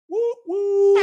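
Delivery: high, loud whooping cheer; excited whooping. Real, not acted. Hyped about the Buffalo trip, whooping loud and high. Hyping Steelers game trip.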